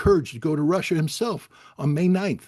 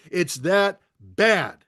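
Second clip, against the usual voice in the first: normal talking voice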